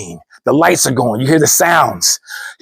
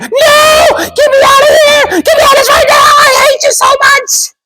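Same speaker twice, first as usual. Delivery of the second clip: screaming crying wife; 6 dB louder than usual. Retold or imitated. Carol begging her husband to leave, high crying scream. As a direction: wail to be let out. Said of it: "Carol's like".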